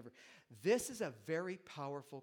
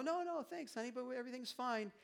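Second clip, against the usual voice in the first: sweet parent reply; 8 semitones higher than usual. Acted. Parents answer their kid.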